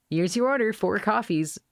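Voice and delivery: lightly voiced waitress. Waitress serves drinks.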